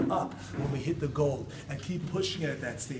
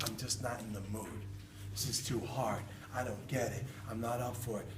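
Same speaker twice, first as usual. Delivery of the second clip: much softer, lower defeated mumble; discouraged; 6 semitones lower, 11 dB quieter than usual. Retold or imitated. Person after falling, softer lower defeated voice. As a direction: give up in a tired sigh.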